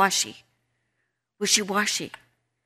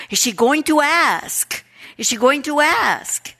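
higher-pitched, suspenseful wondering; eager anticipation; 5 semitones higher than usual. Acted. God waits for her to ask, eager anticipation. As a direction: wonder it aloud, hoping she will.

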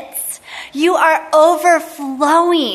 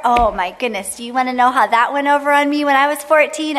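ordinary delivery

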